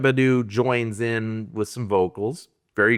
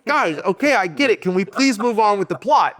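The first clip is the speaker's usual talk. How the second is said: exasperated plea; 8 semitones higher than usual. Retold or imitated. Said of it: "It's like".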